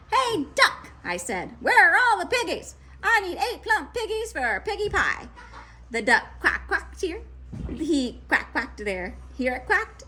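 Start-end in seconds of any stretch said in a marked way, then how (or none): 0.1-5.3 high, loud demand; shouting witch. A narrator's reading. Grinch demands pigs from the duck, shouting. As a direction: bark at the duck. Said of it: "Grinch shouted to a duck".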